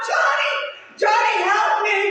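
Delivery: high, loud panicked cry for her brother; screaming for help. Retold or imitated. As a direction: scream his name in terror. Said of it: "screamed out".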